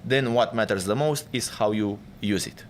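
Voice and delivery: ordinary delivery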